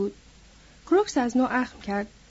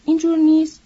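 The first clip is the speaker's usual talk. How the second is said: louder, insistent repeat of اینجور نیست؟; نیست؟ said louder, pressing for an answer; pressing question; 4 semitones higher, 7 dB louder than usual. Acted. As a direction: lean in and push اینجور نیست؟ harder. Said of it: "اندکی به جلو خم شد و با چشمان عمیقش".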